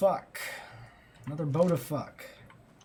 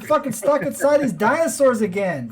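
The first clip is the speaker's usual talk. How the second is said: put-on exasperated Brooklyn accent, louder; 7 semitones higher, 8 dB louder than usual. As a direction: complain in a Brooklyn voice. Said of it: "This is Brooklyn voices on, on again".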